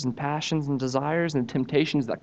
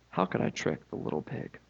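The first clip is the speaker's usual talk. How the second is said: whispered, plotting to himself; scheming whisper; 6 dB quieter than usual. Acted. Wolf plotting alone, whispered. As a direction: muse it under your breath.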